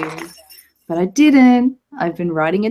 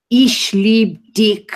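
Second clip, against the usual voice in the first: put-on slow, drawn-out German accent; 3 semitones higher, 5 dB louder, much slower than usual. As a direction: put on a harsh German accent, each word stretched. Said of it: "Please say in your best German accent".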